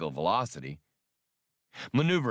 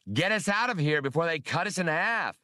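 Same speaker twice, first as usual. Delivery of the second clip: higher urgent yell; 7 semitones higher than usual. A narrator's reading. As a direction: yell over the gunfire to get out now. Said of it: "Han yelled to his first mate".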